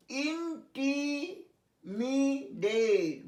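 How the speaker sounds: ইন্টিমিডেট spelled out very slowly, syllable by syllable; careful pronunciation. Shown as a demonstration. Teacher reading a vocabulary word aloud, very slow. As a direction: model ইন্টিমিডেট slowly for the class. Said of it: "আমি পড়ে যাচ্ছি ওয়ার্ডগুলো".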